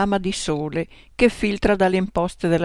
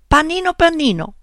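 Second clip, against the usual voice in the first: high, loud call of the cat's name; angry shout; 9 semitones higher, 5 dB louder than usual. Acted. Writer shouts angrily at her lazy cat Panino. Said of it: "urlai arrabbiata".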